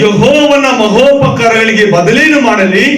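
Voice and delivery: slow, high, solemn verse echo; scripture recitation. Real, not acted. Preacher repeating the psalm after the sister, slow and solemn. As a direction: draw each word out as holy scripture.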